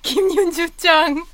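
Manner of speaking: loud laughing self-cheer. Acted. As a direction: cheer your own name, cracking up. Caller cheers herself, laughing.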